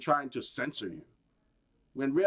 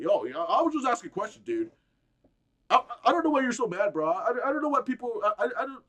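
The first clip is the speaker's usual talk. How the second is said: mock Joe Rogan; 6 semitones higher, 4 dB louder than usual. Mocking Joe Rogan's defense.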